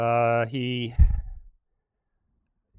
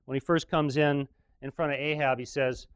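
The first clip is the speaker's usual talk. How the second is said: usual speaking voice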